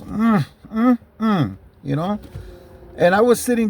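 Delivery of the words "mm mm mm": lightly imitated cop leering; leering hum. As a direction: imitate a creep's appreciative hum. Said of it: "And he goes".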